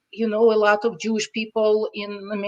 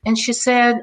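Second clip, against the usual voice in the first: normal talking voice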